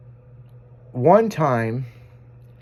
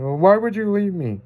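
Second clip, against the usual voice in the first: higher mock pleading dog voice; hurt pug; 9 semitones higher than usual. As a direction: plead like an abandoned pet. Voicing crying pugs.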